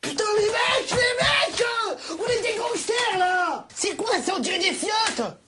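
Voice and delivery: shouting. Acted. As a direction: yell it out in disbelief. Gangsters mocking companions, shouting.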